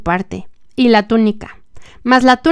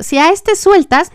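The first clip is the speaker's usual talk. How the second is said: higher, slow crowd shout; 8 semitones higher, 3 dB louder than usual. A narrator's reading. Preacher voicing the mob demanding Jesus die, shouting. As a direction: call it out high and slow as the angry mob. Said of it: "los judíos gritaban".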